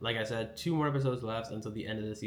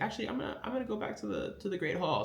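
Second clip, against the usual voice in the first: much higher, nervous stammering retreat; flustered excuse; 11 semitones higher, faster than usual. Retold or imitated. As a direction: backpedal in a hurry, flustered. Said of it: "it gets all stuttery".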